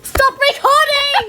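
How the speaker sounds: loud shout. Real, not acted. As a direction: yell it out. Kid yells at recording sibling.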